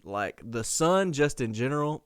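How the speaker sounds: ordinary talk, nothing marked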